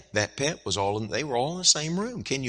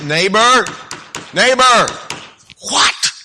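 loud, much higher call through the door; 9 semitones higher, 7 dB louder, slower than usual. Acted. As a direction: call up to the sleeping house. Calling neighbor again.

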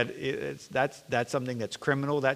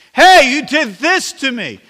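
much higher and louder, slow indignant accusation; accusing outburst; 12 semitones higher, 15 dB louder than usual. Retold or imitated. Offended church member confronting someone, slow loud accusation. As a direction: throw the blame out like the whole room should hear.

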